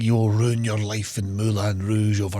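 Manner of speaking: slow, heavy put-on foreign accent, Scottish-tinged; mock father's accent. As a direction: imitate the dad's odd accent, drawn out. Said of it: "it doesn't have a Scottish accent".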